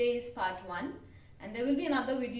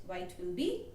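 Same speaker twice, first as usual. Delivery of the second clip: ordinary delivery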